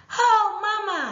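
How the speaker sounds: high, loud excited cry; calling Mama. Shown as a demonstration. The girl runs home calling her mother, high and loud. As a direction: call out to Mama at full pitch. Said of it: "repeat of passage".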